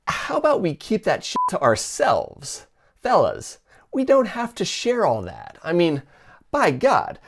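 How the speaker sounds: high-pitched, exasperated plea; exasperated scolding. Acted. Host telling the fellas to stop sharing, exasperated.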